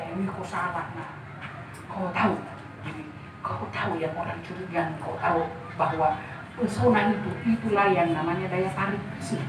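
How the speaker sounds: a hint of a low, soft father's voice. Father calmly corrects his daughter, lightly voiced low and soft. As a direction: gently correct her like a wise father. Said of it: "permainan suara ayah saya".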